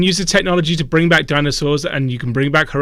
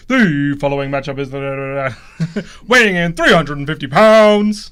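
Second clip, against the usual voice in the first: boxing ring announcer; 4 dB louder than usual. Retold or imitated. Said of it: "like a boxing ring announcer's voice".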